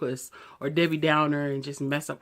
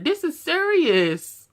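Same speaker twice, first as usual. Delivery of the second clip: much higher and louder, snapping back; furious retort; 12 semitones higher, 7 dB louder, much slower than usual. Acted. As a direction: shout it straight back at them. Retort to dismissive people.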